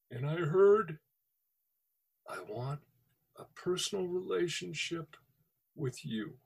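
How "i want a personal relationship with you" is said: hushed inner voice. Retold or imitated. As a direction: speak it like a quiet voice in the heart. Retold voice of God.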